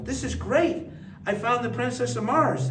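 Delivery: high, loud delighted exclamation; acting out delight at the find; thrilled. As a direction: burst out with glee at the find. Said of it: "I go".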